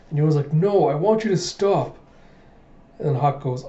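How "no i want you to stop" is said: higher-pitched acted objection; protesting. Princess telling a suitor off, mildly upset.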